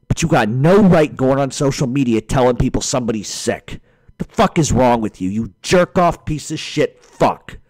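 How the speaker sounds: heated tirade with hard-hit curses; real enraged rant. Real, not acted. Man berating someone who spread news of an illness, enraged. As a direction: tear into him, outraged. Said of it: "I'm so fucking enraged".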